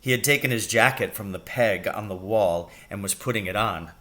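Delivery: plain reading voice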